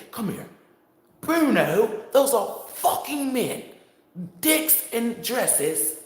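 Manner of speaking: high, drawn-out mock outrage; scandalized alarm. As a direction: alert a friend in appalled amazement. Acts out man alerting his friend.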